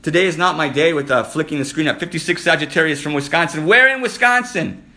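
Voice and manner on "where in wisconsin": higher, louder and slower, drawn out with eager interest; eager question. Real, not acted. Host asks a viewer where in Wisconsin, eager and drawn out. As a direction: stretch the question out, really curious.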